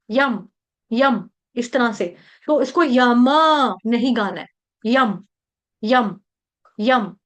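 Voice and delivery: यम with the lips shut fast on म; quick lip close. Shown as a demonstration. Teacher drills the short यम. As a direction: snap the lips closed right after यम. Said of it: "फटाफट होठ बंद कर देते हैं".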